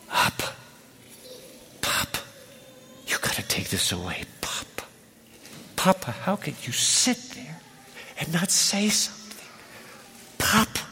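soft whispered sobbing begging; sobbing plea. Acted. Jesus sobbing to his Father in the garden, whispered plea. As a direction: plead through tears, barely voiced.

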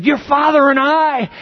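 high, loud reproach; reproachful mother. Acted. Mary to Jesus, high loud reproach. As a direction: throw it at him. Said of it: "Mary comes to him and she said".

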